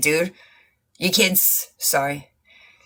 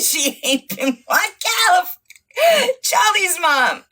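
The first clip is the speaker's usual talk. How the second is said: cry laughing; 8 semitones higher than usual. Real, not acted. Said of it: "Bitch, I am cry laughing".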